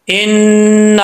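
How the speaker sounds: इन्ना with the n and a drawn out long; stretched madd. Shown as a demonstration. Teacher showing students how to stretch, held long. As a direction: stretch इन्ना for two beats. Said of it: "तो कैसे खींचेंगे".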